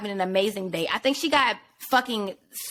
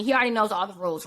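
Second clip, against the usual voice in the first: usual speaking voice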